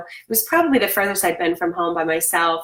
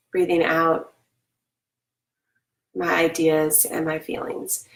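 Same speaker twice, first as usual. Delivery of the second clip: guided breathing; slower than usual. Acted. Coach guiding kids through a breathing affirmation, calm and slow. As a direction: breathe it out calmly with the class.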